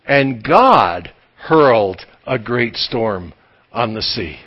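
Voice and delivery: slow, weighty and falling low; solemn emphasis. Real, not acted. Preacher reads scripture slowly so they mark it. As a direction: lay each word down heavily. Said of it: "and mark these words".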